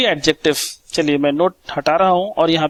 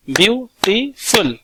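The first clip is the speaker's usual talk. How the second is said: Beautiful split slowly into its three beats; teacher sounding out beautiful in beats; syllable by syllable; 3 semitones higher, much slower than usual. Shown as a demonstration. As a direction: clap out beautiful, one beat per syllable. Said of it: "जैसे मैं clap कर कर बताऊंगा आपको".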